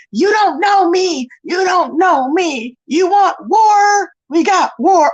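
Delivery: slow, drawn-out war threats in imitation; mock tough guy. Streamer imitates a rival declaring gang war, hammed-up tough talk. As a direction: stretch each word like a hammed-up warmonger. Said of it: "he's over there going".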